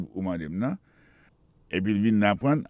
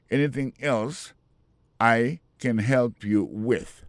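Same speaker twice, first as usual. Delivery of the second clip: slowly shape each word for learners; teacher slowing down the question; slow model phrase; slower than usual. Shown as a demonstration. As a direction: stretch out "help" very slowly. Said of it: "Èske gen lòt bagay ankò".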